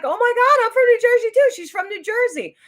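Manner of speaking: imitated high, loud excited squeal; excited outburst. Picture-taker learns they share a home state, excited outburst. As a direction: cry out like a thrilled fellow fan.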